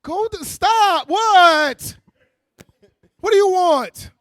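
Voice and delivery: loud, high exasperated snap; fed up with the whining child; mock angry parent yell.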